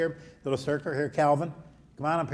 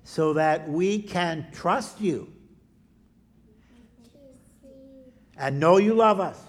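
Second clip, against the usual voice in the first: put-on slow, louder prayer lines for kids to repeat; echo prayer; 6 semitones higher, 7 dB louder than usual. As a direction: pray it out slowly so the children can echo each phrase. Leads children in echo prayer.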